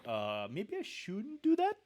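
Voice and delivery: high, slow, unsure; hesitant doubt. Retold or imitated. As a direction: wonder aloud if you should quit. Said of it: "You'd be kind of like".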